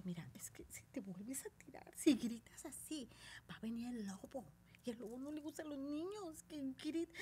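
soft, whispered story warning; hushed warning. Retold or imitated. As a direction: scare the kids gently, whispering. Calmando a niños con el lobo. (advertencia susurrada a los niños)

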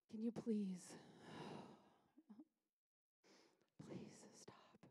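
whispered, sighing plea; weary mother pleading. Acted. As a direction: beg in a worn-out whisper.

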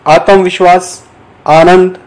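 ordinary delivery

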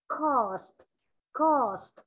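COST with a distinct S and released T; clear final sounds. Shown as a demonstration. Teacher shows class clear endings, COST with audible final ST. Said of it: "có âm đuôi là rõ ràng luôn".

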